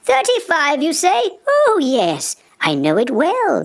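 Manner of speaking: high, smug and pleased; sly smiling wolf. Acted. Wolf learning the address, smug and smiling. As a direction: smile through it, scheming. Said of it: "with a smile".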